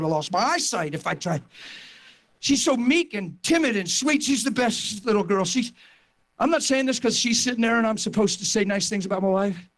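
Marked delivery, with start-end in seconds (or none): none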